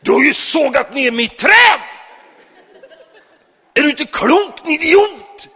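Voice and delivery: furious high shout; neighbour shouting. Retold or imitated. Angry neighbour yelling at the pastor. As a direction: bellow it like an enraged giant. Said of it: "stod han och skrek åt".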